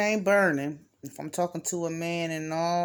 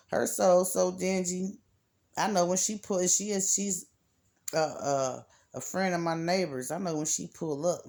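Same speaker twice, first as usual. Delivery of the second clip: ordinary delivery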